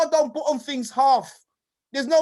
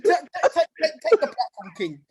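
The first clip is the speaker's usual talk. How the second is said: normal talking voice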